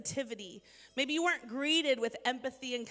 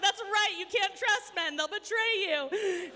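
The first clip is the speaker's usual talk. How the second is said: high, loud wailed lament, hammed-up; mock tearful wail; 8 semitones higher, 5 dB louder than usual. Woman retelling her crying over men, mock tearful wail. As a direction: play up a tearful, betrayed complaint, drawn out at the end. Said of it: "And then I cried even more".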